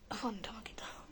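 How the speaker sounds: quick hushed whisper off-mic. Real, not acted. Mother whispering to grandmother for the phone. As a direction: whisper an aside so the stream won't hear. Aside to grandmother during live.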